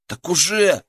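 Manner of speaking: eager. Acted. Soldier answers the commander.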